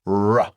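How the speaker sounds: "R" as a loud phonics sound, not the letter name; isolated R sound. Shown as a demonstration. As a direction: voice "R" as a drawn-out rolling-in "rrruh". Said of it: "we've got the first sound there".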